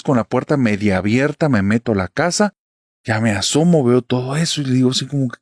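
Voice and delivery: ordinary talk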